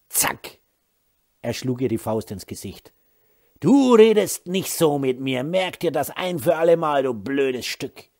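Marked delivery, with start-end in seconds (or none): 3.6-8.1 loud menacing anger. Acted. Husband after punching wife.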